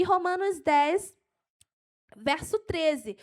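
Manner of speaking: excited preacher reaching the last verse; carried away. Real, not acted. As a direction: deliver the citation with enthusiasm. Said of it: "eu me empolgo".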